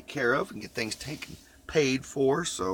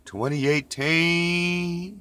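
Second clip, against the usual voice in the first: sing-song; 7 semitones higher, 4 dB louder, much slower than usual. Acted. Repeats date playfully.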